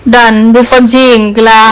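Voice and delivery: put-on teasing declaration to the sulking child; mock announcement. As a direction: declare the winner with playful pomp.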